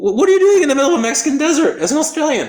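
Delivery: high, loud, rapid-fire astonishment; re-enacted bewilderment at full pitch; incredulous question. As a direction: stammer into a fast, high-pitched demand. Astonished question in retold desert meeting.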